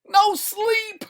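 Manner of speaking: very high, loud scream. Acted. Delirious man refusing rest, scream. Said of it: "screamed Sale".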